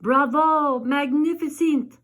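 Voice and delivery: loud bravo shout; grand dance teacher shouting praise; Madame Maladroit's cry. Acted. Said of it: "Madame Maladroit cried".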